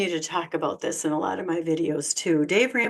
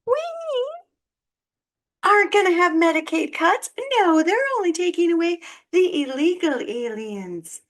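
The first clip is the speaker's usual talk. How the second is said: high, loud sing-song mockery of a Trump supporter; mocking Trump supporters; 10 semitones higher, 3 dB louder than usual. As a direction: parody them as smug and clueless. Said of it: "Trumpers are still going".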